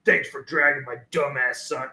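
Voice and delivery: low, understated angry dad; mad dad. Retold or imitated. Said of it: "his dad was so mad".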